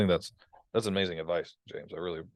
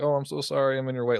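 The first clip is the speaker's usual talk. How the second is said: higher, louder fawning apology; mock apologetic; 6 semitones higher, 3 dB louder than usual. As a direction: grovel mockingly to the customer. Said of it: "people wanna be apologetic".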